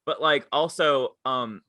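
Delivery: ordinary delivery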